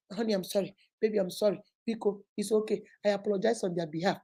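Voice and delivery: quiet, placating apologies; smooth things over meekly; soft apologetic wife. Retold or imitated.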